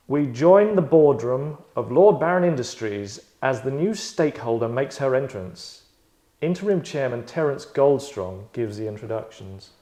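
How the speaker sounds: slow, loud scene-setting announcer read; game master narration. A narrator's reading. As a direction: set the scene like a grand narrator.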